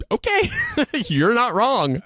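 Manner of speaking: laughs out a high okay, then concedes; laughing okay. Retold or imitated. Laughing, to a friend telling him to quit the Army. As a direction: crack up, then admit he's not wrong.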